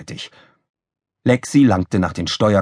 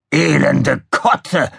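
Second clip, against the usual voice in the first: slow, drawn-out curse, pitched up; Krawall, disgusted exclamation; 6 semitones higher, 3 dB louder, much slower than usual. A narrator's reading. Officer sees slime hit the window, disgusted. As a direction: let the curse slip out slowly. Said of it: "entfuhr es Krawall".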